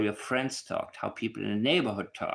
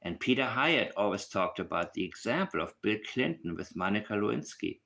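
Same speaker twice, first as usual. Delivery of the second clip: ordinary talk, nothing marked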